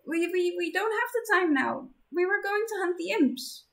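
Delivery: put-on blushing shy girl; flustered girl voice. Embarrassed Zenith.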